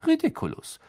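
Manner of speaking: almost casual spell, without a shout; Lupin. A narrator's reading. Teacher casting at the silvery orb, almost casually. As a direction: toss the spell off lightly. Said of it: "sagte er fast lässig".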